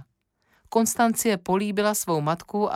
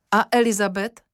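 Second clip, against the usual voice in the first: pressing, higher and louder; Emílie insisting with Mrs Serise; urgent question; 7 semitones higher, 6 dB louder than usual. Acted. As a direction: push hard for an answer. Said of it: "naléhá Emílie".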